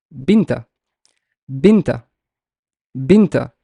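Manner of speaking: Binta said distinctly, stress on first syllable; teacher carefully sounding out Binta; careful pronunciation. Shown as a demonstration. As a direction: model Binta as a separate, falling word. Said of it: "How would I pronounce this?".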